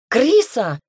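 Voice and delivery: high, loud outburst of panic; hysterical cry. A narrator's reading. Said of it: "истерит Агата". (истеричный вскрик)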